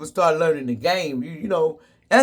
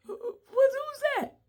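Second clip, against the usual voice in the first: very high, slow frightened cry; child hearing grandma's voice, scared and high; startled child; 8 dB quieter, slower than usual. Retold or imitated. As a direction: squeak it like a scared kid.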